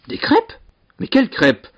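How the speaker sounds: very high, startled question; incredulous inquiry. A narrator's reading. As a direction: ask in utter bafflement, starting way up high. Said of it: "s'enquit le seigneur".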